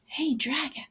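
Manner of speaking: very soft breathy whisper. Acted. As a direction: check if he's asleep, barely a whisper. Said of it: "Elizabeth whispered very softly".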